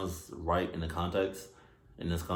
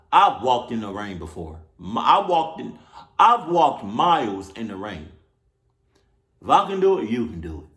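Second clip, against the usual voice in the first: loud lecturing; 3 semitones higher, 7 dB louder than usual. Real, not acted. Lecturing the hitchhiker.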